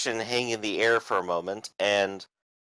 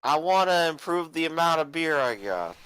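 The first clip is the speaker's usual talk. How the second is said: put-on higher, slurring rowdy drunk; 6 semitones higher than usual. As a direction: play a belligerent tavern drunk.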